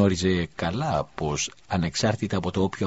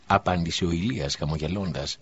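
ordinary delivery

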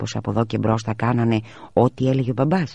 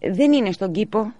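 high, worried mother; mother's voice; 12 semitones higher than usual. A narrator's reading. (ανήσυχη μητέρα, ψηλή φωνή)

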